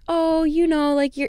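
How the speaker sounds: put-on sweetened baby talk from a mean dad; imitating the dad turning nice in line; mock nice dad. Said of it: "he was being nice".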